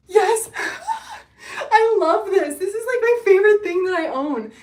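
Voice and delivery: high, loud, laughing and near tears with joy; real overjoyed laughing shriek; squealing with delight. Real, not acted. Shown the same hoodie by viewer.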